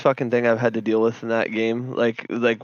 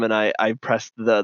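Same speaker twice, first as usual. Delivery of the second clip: usual speaking voice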